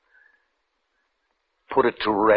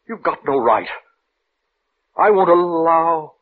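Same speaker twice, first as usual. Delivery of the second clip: angry outburst; 7 semitones higher, 5 dB louder than usual. Acted. Minister forbidding Victor to go on, angry outburst. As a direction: plant your feet and forbid it.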